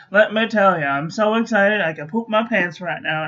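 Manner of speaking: usual speaking voice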